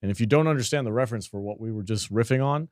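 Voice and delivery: normal talking voice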